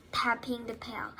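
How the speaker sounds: ordinary delivery, nothing marked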